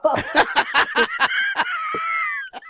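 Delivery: sudden high-pitched burst of laughter. Real, not acted. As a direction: squeal of laughter. Laughing after admitting not knowing song.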